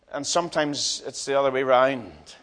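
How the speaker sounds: usual speaking voice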